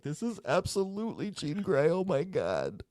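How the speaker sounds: high, soft, amazed gasp of recognition; hushed squeal of fan disbelief; awed realization. Retold or imitated. Retells her awed realization in theater.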